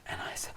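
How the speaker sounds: a whispered sharp gasp. Retold or imitated. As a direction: gasp in awe, no voice. Seeing heavy metal channel.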